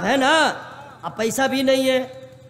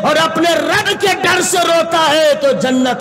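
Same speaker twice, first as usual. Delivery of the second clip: higher, louder full-force sermon; fiery preaching; 5 semitones higher, 5 dB louder than usual. Acted. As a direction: build the crowd with rising fervor.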